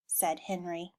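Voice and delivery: usual speaking voice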